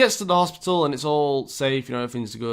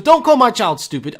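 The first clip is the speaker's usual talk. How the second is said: much louder, higher outraged shout; retold furious retort; 7 semitones higher, 8 dB louder than usual. Mother yelling back at the dog owner, furious shout. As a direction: fire it back at full volume. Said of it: "And she goes".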